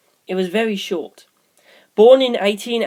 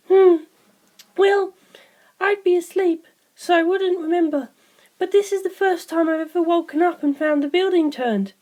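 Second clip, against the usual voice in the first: put-on much higher, light young girl voice; Sugimoto's voice; 12 semitones higher than usual. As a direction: lift into a bright girlish voice for her. Narrator voices Sugimoto at length.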